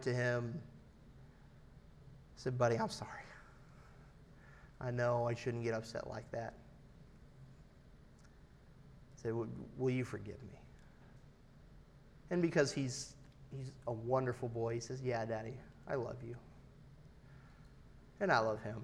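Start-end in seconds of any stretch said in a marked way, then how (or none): 2.6-10.6 put-on quiet, contrite plea to a child; soft apology. Retells apologizing to his son. 15.0-16.3 soft, gentle retold child's reply; hushed, tender reassurance; tender forgiveness. Voices son forgiving him.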